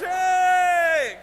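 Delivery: very high, loud, long cheer; roaring imitation of a delighted crowd. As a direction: shout a huge drawn-out cheer. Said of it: "And the crowd goes".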